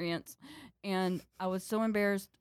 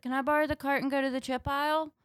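lightly voiced child, pitched up; a higher, feigned-casual ask; innocent kid request; 7 semitones higher than usual. Daughter asking mom for the cart, innocent kid request.

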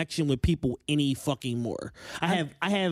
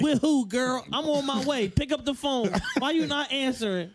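hyped friend voice; 9 semitones higher than usual. Retold or imitated. Playing hyped commenter.

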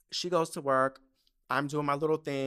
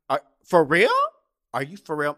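loud, higher burst of disbelief; incredulous; 3 semitones higher, 12 dB louder than usual. Retold or imitated. Teen reacting to mom offering to buy tickets, incredulous. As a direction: blurt it out stunned, first one much louder.

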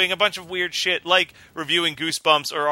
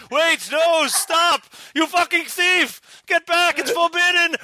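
spooky voice; 13 semitones higher, slower than usual. Acted. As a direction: yell in alarm at a fleeing thief. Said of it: "should I do a spooky voice".

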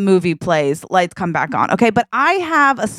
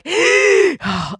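high gasp of panic; big gasp; 11 semitones higher than usual. Retold or imitated. Frightened girl in the ride line, high gasp. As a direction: gasp in mock terror. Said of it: "And I was like".

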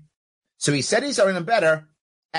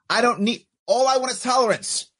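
emphatic shout; 6 semitones higher than usual. Real, not acted. Shouting his point.

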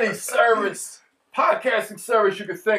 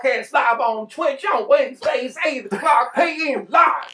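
put-on country accent; 3 semitones higher than usual. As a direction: shout it like a country DJ. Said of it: "Every outro has to be done like that".

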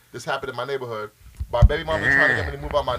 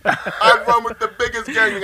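loud brag through laughter; laughing brag; 7 semitones higher, 6 dB louder than usual. Pretend.

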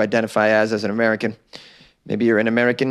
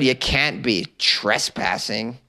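usual speaking voice

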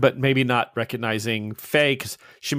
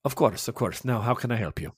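lightly voiced Italian priest, courteous and mild; a hint of a kindly Italian father greeting a guest; 3 semitones lower, 7 dB quieter than usual. The priest greeting Faye, lightly Italian and courteous.

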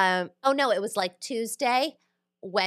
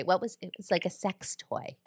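much softer, lowered voice; hushed aside; 9 dB quieter than usual. Pretend. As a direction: drop your voice for something delicate. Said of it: "Okay, I'm whispering".

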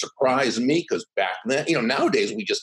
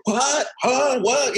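mock baffled crowd; 13 semitones higher, much slower than usual. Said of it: "and everybody would".

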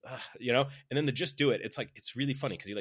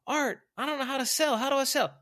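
very high, loud mock plea; complaining follower; 8 dB louder than usual. Struggling worker asking Art for advice, high mock plea. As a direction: imitate someone bringing a problem to the boss. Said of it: "people coming up to me saying".

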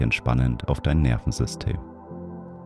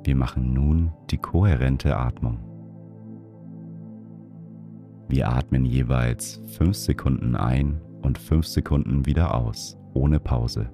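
guided meditation; 3 dB quieter than usual. Acted. Leads a breathing meditation.

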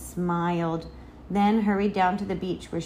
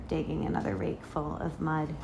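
ordinary delivery

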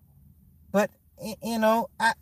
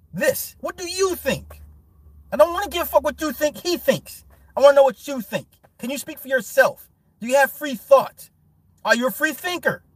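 heated rant; 6 semitones higher than usual. Real, not acted. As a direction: press them hard, exasperated. Ranting challenge at fans.

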